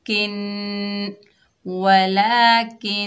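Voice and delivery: वलाकिन very slow with the n hummed through the nose; nasal ghunna. Shown as a demonstration. Teacher demonstrates ghunna in वलाकिन slowly. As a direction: hide the voice in the nose on किन. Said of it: "गुन्ना नाक में आवाज़ छुपाने".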